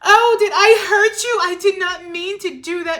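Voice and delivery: high, loud, syrupy mock sorry; fake apology. The ex faking remorse to the partner, high and loud. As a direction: overdo the apology like you don't mean it. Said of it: "make them think I'm just so kind".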